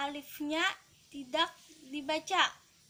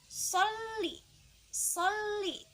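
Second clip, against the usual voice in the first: "Sholli" raised high, double l held, then dropping; ditekan dan ditahan; 5 semitones higher, much slower than usual. Shown as a demonstration. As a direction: hold the ll in "Sholli" on a high note. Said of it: "Harus ditekan dan ditahan". ("Sholli" tinggi, lam ditekan dan ditahan)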